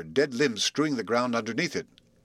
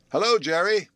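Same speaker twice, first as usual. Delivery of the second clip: loud, high call toward the tree; 7 semitones higher, 4 dB louder than usual. Acted. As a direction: call into the hollow trunk. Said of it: "he called".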